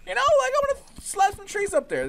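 giddy high-pitched announcement; excited. Real, not acted. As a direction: pitch it way up, thrilled with the idea. Excited idea for tree roof.

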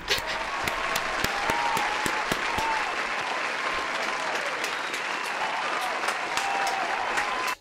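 long shrieking laugh after a scare; long high laugh. Real, not acted. Player laughing off a jump scare, long high laugh.

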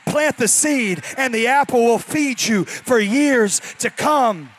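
loud, building preacher's cry; preaching at full force. Real, not acted. Pastor urging the church to trust God, full preaching. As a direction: preach it out, rising to the peak.

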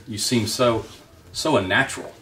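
ordinary delivery, nothing marked

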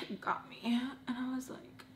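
real choked-up whisper, barely voiced; fighting tears. Real, not acted. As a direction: whisper it, holding back tears. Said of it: "don't cry".

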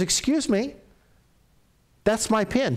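indignant objection. Retold or imitated. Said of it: "She goes".

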